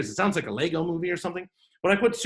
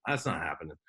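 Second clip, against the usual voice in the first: dismissive; 11 semitones lower, 8 dB quieter than usual. Retold or imitated. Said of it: "And I was like".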